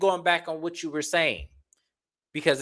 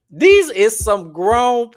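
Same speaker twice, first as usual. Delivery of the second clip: emphatic; 8 semitones higher, 10 dB louder, much slower than usual. Real, not acted. Ranting emphasis to viewers.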